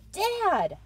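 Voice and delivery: loud high shout; pleading shout of Dad. Acted. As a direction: yell at him to stop. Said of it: "I shouted".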